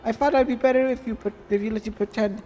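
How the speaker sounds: lightly voiced desperate plea; acted pleading. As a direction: beg her to come back, half-hearted.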